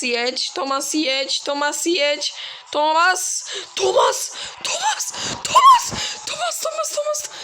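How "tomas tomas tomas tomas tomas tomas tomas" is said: calls rising into a very high squeal; escalating squeals. Real, not acted. Gamer squealing at Tomas as he nears a crash. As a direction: squeal his name higher and higher.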